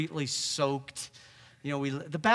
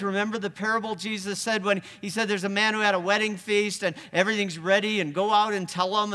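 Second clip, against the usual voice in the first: ordinary talk, nothing marked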